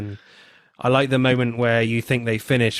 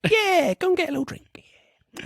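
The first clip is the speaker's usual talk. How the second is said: much higher camp voice; faster than usual. Retold or imitated. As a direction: pitch it way up like a sulky diva. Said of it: "You know, like that".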